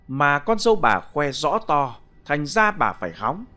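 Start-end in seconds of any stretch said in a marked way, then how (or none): none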